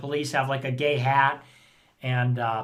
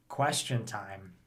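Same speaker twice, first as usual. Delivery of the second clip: much lower, quiet, slow Question time; mock low announcement; 8 semitones lower, 10 dB quieter, slower than usual. Shown as a demonstration. Streamer mocking question time, low and slow. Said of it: "mocking the phrase".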